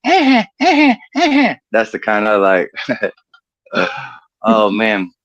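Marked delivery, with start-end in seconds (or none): 0.0-1.6 high-pitched dolphin chatter, in short bursts; dolphin squeal. Acted. Comic punchline of a woman looking back, high dolphin squeals. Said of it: "she looks back and goes".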